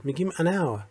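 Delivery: usual speaking voice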